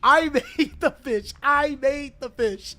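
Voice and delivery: high, playful, repeated protest; mock insistence. Artist defending his unseen fish to viewers, playful insistence. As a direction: insist through a laugh, slowing down. Insists while laughing at himself.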